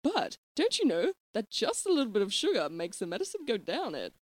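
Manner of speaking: lilting, swooping nanny; exaggerated up-and-down melody; Mary Poppins. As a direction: chide sweetly in sing-song. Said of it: "I'm Mary Poppins".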